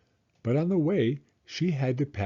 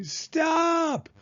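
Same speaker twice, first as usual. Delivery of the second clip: high shrill-free cry, much higher; yelled stop. A narrator's reading. Girl yelling at the man lifting her by the hair, high cry. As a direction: cry out to make him let go. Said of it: "Taya yelled very loudly".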